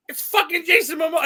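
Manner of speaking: excited realization. Retold or imitated. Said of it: "I was like".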